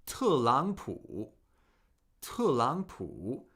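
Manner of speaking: "Telangpu" slow, with each syllable's tone shown; careful teacher's model of Telangpu; Mandarin tones. Shown as a demonstration. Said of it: "Notice we have a fourth tone".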